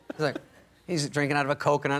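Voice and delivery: ordinary talk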